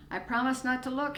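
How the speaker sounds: calling after her. A narrator's reading. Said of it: "he called after her".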